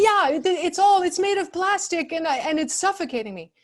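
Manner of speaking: high, loud, worked-up complaint; agitated customer. Retold or imitated. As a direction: whine it out as a frantic customer. Agitated customer acted in role-play.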